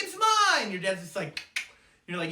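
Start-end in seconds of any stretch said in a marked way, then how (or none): 0.0-0.7 very high, loud bratty retort; hammed-up squealed claim; escalated sibling squabble. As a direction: top your brother with a shrill mock yell. Brother escalates the fight.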